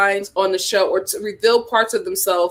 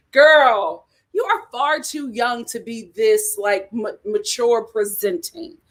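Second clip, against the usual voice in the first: usual speaking voice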